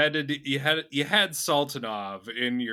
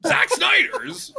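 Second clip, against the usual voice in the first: high-pitched, pleading celebrity impression; Seth Rogen; 11 semitones higher, 4 dB louder than usual. Friend questioning Brad's film recommendation, Seth Rogen voice. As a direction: climb high and stretch the last name. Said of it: "doing the Seth Rogen voice".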